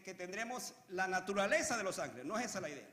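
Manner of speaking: usual speaking voice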